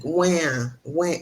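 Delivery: a drawn-out pretend "wah"; imitated crying. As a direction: imitate someone crying, lightly. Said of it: "like, crying".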